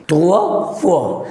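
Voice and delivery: تروا فوا drawn out slowly, stressing the wa; teacher shows the oi said as وا; slow wa sound. Shown as a demonstration. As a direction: stretch the وا in each word. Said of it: "أنا قلت وا".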